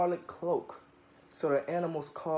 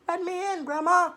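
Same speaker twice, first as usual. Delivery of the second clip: higher, louder merry call; called merrily; 13 semitones higher, 4 dB louder than usual. Acted. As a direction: call out cheerfully to Grandma. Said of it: "she called merrily".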